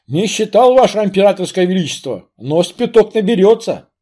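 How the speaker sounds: бодро и громко, по-солдатски; отрапортовать с удалью; громкий бравый солдат. Acted. Солдат отвечает царю. (громкий бравый солдат)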